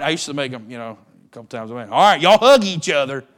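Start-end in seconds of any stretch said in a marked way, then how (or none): 1.9-3.2 loud bossy parent order; parent's command. Retold or imitated. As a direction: bark it at squabbling kids. Parent ordering twins to hug.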